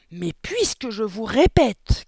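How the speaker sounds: high, loud, slow insistence; stretched-out indignant yell; shouted protest. A narrator's reading. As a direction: cry out in exasperation. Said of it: "cria Mathilda".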